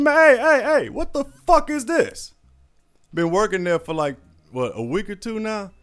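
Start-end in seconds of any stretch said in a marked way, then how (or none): 0.0-2.3 high, loud outraged yell; hammed-up shrill outrage at a tiny paycheck; freaking out. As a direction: shriek it like he's been robbed. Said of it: "he lost his damn mind".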